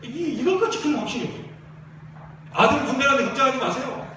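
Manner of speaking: fiery pastor rallying the congregation; preaching at full force. Acted. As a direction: drive it home to the church like a revival preacher.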